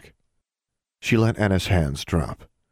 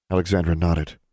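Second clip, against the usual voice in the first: ordinary talk, nothing marked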